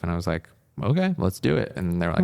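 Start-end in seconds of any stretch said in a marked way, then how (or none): 0.8-1.7 pitched up high, a quick keen yes; eager yes. Retold or imitated. Telling the advisers she's in, with a high eager yes. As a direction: jump at the offer, higher. Retold eager agreement to double major.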